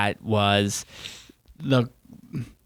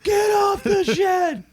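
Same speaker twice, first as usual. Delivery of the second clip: very high, stretched-out mock scream; imitated screaming; slower than usual. Dad in a skit ordering someone off the shed, screaming. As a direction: suddenly scream the order, dragging out the words. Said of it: "then he starts screaming".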